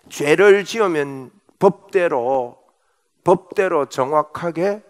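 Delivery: slow, soft, weighty words; slow and deliberate. Real, not acted. Preacher stressing justice to the congregation, slow and grave. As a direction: lay it down slowly, word by word.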